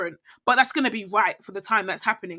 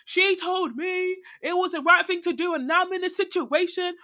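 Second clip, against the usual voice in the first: pitched-up mock whine about older self; higher, half-joking grumble at older self; mock complaining; 8 semitones higher than usual. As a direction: whine it like a wronged kid. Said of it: "cuss my older self".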